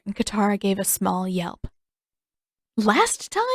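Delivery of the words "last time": put-on high, puzzled girl; startled question. A narrator's reading. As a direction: catch the odd phrase. Katara confused by mention.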